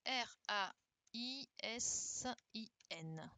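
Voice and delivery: slow letter-by-letter spelling; spelled out. Shown as a demonstration. Teacher spelling the first answer for pupils, spelled out slowly. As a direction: spell it out, pausing between letters.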